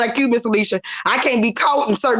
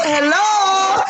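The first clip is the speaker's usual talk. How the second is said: high, drawn-out mock-gangster hello; lightly voiced gangster swagger, pitched up; Tony Montana; 7 semitones higher than usual. Telling the panel God is a gangster, hammed-up Tony Montana. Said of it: "that thorny Montana came out".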